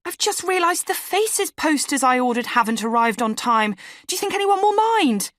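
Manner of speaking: high, rising worried voice; anxious fretting. A narrator's reading. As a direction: fret aloud, pitch climbing. Narrator voices own anxious self.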